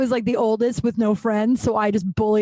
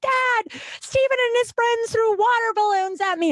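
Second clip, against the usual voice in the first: high-pitched whiny cry to dad, much higher; retold crying tattle; 14 semitones higher than usual. Girl crying to her dad about the water balloons, high-pitched tattling wail. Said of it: "And I'm crying, I'm yelling".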